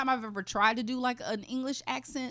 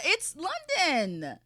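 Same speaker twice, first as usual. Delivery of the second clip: put-on London accent; 6 semitones higher, much slower than usual. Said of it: "is that London or is that Australia".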